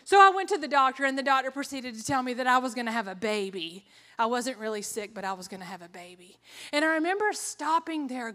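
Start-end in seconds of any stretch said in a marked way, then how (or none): none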